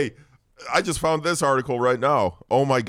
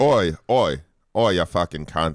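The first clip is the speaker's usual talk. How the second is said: broad put-on Australian accent; 5 semitones lower, slower than usual. Host greeting his class for a bit, Australian accent. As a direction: greet them in a rough attempt at Australian. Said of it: "I was going Australian".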